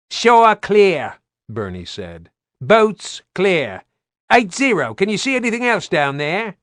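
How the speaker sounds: high, loud radio call; radio report. Acted. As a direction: report crisply over radio.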